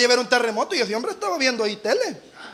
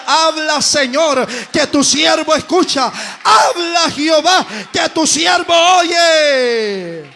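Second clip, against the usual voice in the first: fervent cry; 3 semitones higher, 6 dB louder, much slower than usual. Acted. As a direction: preach it out at full force. Quoting Samuel's cry, fervent.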